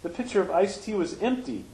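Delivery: normal talking voice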